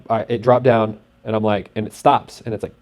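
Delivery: usual speaking voice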